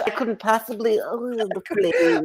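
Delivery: high, slow swooning whimper; helpless damsel. Pretend. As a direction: fake fragile swoon.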